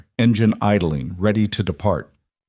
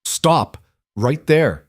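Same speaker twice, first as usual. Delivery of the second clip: sharp, high shouted order; shouted command; 6 semitones higher, much slower than usual. A narrator's reading. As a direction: stop him in his tracks at gunpoint. Gunman orders Liam to halt.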